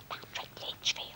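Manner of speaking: hushed, awestruck whisper; retold gasp of disbelief, whispered; stunned whisper. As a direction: whisper the name as if you can't believe it. Retells stunned reaction to photographer.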